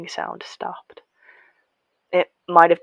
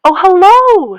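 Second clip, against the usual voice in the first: put-on much higher, loud and sweet old woman; oddly friendly; 14 semitones higher, 13 dB louder, slower than usual. A narrator's reading. Old gardener greets the children, high and friendly. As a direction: greet them like long-lost family. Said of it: "sounding oddly friendly".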